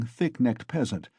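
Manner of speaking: usual speaking voice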